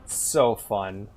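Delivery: ordinary talk, nothing marked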